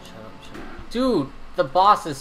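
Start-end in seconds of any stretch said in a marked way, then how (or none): 0.0-0.9 whispering. Real, not acted. Hushing partner during fight.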